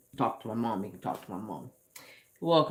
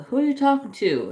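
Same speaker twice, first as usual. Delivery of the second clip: much higher, louder imitated stepmom; stepmom asking; 14 semitones higher, 7 dB louder than usual. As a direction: mimic the wife catching him talking. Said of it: "she'll be like".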